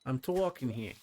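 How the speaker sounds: lightly done Brooklyn tough guy; a hint of Brooklyn swagger; Brooklyn accent. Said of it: "talking in my Brooklyn accent here".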